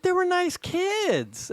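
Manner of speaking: high, slow chiding voice; chiding wife. Retold or imitated. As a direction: chide him slowly, up high. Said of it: "She went".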